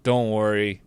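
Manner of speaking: ordinary talk, nothing marked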